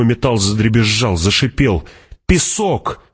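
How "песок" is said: high-pitched, contained outcry; narrated cry. A narrator's reading. Jessica warns of sand in the storm, mild high cry. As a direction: cry out high without real volume. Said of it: "закричала Джессика".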